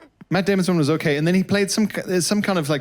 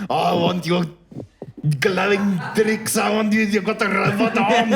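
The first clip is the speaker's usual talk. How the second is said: hammed-up goblin voice with heavily rolled R's; slow, high mock goblin accent; Andy Serkis; 5 semitones higher than usual. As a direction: do a bad South African villain, rolling every R. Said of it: "Andy Serkis is there going".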